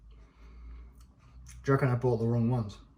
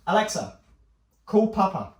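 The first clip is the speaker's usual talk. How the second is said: smart speaker command; 6 semitones higher than usual. Real, not acted.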